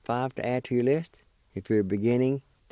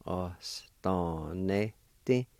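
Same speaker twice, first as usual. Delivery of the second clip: very slow, careful "Adayostanehti"; teacher drawing out "Adayostanehti" syllable by syllable; slow demo; much slower than usual. Shown as a demonstration. Teacher to Cherokee learners, slow careful demo. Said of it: "It's pronounced like this".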